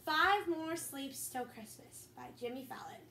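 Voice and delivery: a steady read-aloud storybook voice; reading to children. Acted. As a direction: read it to the kids like a bedtime story. Reading picture book aloud.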